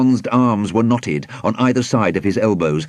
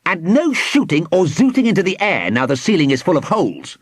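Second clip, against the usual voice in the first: shouted order; 8 semitones higher than usual. A narrator's reading. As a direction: shout a bossy warning. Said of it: "Squidgy Moot shouted".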